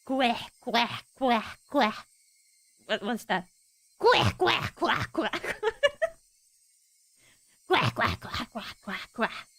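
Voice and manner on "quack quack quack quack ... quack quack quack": laughing quack. Acted. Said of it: "laughed together with a chicken laughter".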